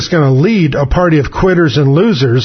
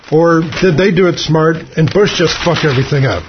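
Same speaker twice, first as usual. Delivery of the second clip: normal talking voice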